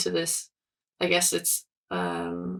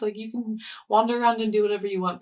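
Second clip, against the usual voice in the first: fast, louder irritated tone; huffy brush-off; irritated stranger; mild annoyed rant; 4 semitones higher, 6 dB louder, faster than usual. Retold or imitated. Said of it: "pissed someone off".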